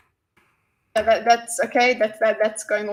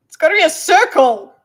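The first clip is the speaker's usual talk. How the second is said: loud, raised-pitch imitation; mock quoted outburst; 5 semitones higher, 12 dB louder than usual. Streamer imitating what he goes on about, loud mock insistence. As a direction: mimic him in a loud, high burst. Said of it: "'cause he goes".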